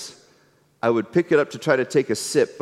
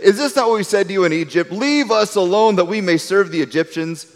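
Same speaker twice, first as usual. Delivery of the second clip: higher, fast, self-righteous whine; whiny mock complaint; 7 semitones higher than usual. People grumbling to Moses, fast and higher-pitched. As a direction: rattle it off like a grumbling crowd. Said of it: "they're trying to say".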